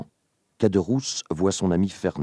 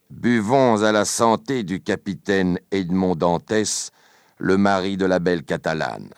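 louder toast with a touch of venom; hateful tone; 4 semitones higher, 6 dB louder than usual. A narrator's reading. Danglars toasts his rival while refilling glasses, hateful. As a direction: propose the toast with understated hatred. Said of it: "dit-il d'un ton haineux".

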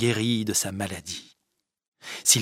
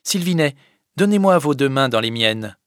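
higher, louder firm order; commanding tone; 4 semitones higher, 7 dB louder than usual. Acted. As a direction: give the command, no room for argument. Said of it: "d'un ton de commandement". (ton de commandement, plus haut et plus fort)

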